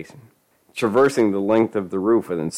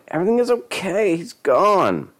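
very high, agitated reassurance; insistent woman; 12 semitones higher than usual. A narrator's reading. Lisa argues with her mother.

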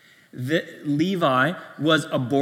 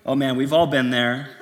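ordinary talk

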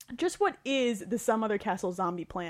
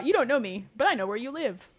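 ordinary talk

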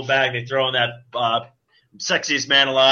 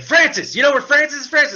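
higher, taunting sing-song call for Francis; mock Deadpool; 3 dB louder than usual. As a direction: tease him as you hunt. Imitating Deadpool hunting Francis.

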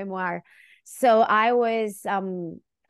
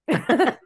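quick burst of laughter; short laugh; 4 semitones higher, 5 dB louder than usual. Real, not acted. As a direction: laugh at your own joke. Laugh after joke.

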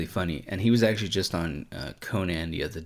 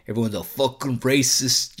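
loud, badly faked Boston accent; botched Boston accent; 4 semitones higher, 5 dB louder than usual. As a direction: have a bad go at a Boston accent. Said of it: "what accent was that".

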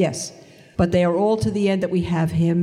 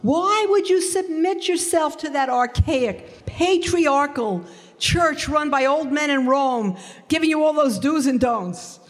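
hammed-up friend protesting the church; mock indignant friend; 9 semitones higher than usual. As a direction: mimic her scorn, pitched well up. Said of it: "she said to me".

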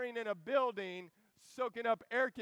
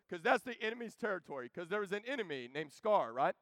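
normal talking voice

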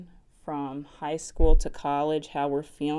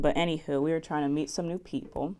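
ordinary talk, nothing marked

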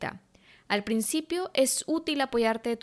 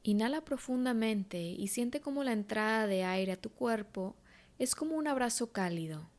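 guided meditation; 6 dB quieter than usual. Acted. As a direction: guide her gently into calm.

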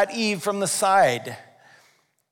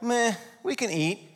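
higher-pitched, half-hearted shrug in the voice; indifferent meh; 5 semitones higher, 4 dB quieter than usual. Acted. Eve repeating God's generous promise, indifferent. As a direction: toss it off without enthusiasm. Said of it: "Eve's answer is kinda like".

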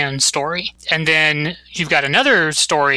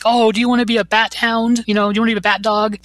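Bruce voice; 7 semitones higher, faster than usual. Retold or imitated. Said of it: "And Bruce is like".

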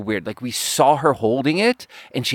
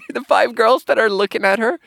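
talking through laughter, pitch up; can barely get the words out for laughing; 10 semitones higher than usual. Real, not acted.

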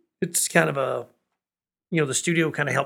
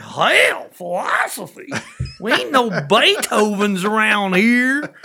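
yokel retort; 7 semitones higher, 10 dB louder, much slower than usual. Retold or imitated. Retelling patron's line again.

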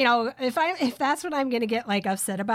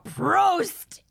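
high, louder toast after a burp; mock hearty toast; 6 semitones higher, 4 dB louder than usual. Retelling her mom's burp ritual, loud and high. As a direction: call it out like a toast. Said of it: "she'd go".

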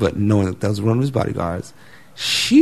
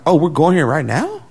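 surprised delight; 6 semitones higher than usual. Retold or imitated. Surprised by a sudden scene cut.

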